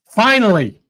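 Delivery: voicing God with a knowing sigh of relief; God's mock exasperated finally at last; sarcastic finally. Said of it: "God did not say it that sarcastically".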